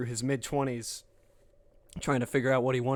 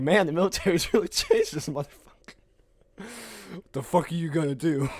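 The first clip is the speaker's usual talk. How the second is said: amused outburst; 5 semitones higher, 7 dB louder than usual. Retold or imitated. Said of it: "I was just like".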